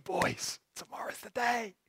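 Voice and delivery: put-on soft, hushed word to the boys; hushed disciple. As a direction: whisper it under your breath. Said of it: "it's like".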